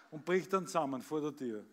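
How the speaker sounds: lively storytelling recital, acting out each voice; comic poem recitation. Reciting a comic poem for the birthday audience, performed recital.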